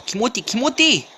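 high excited yelling; hyped gamer shout. Real, not acted. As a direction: cheer yourself on mid-fight. Battle cry. (신나서 외치는 게이머)

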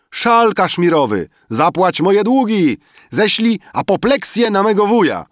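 high, loud volley of wishes; clamoring demands. A narrator's reading. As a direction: shout out wishes like a greedy crowd. Guests shout greedy wishes. (wysokie, głośne okrzyki z żądaniami)

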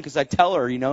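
ordinary delivery

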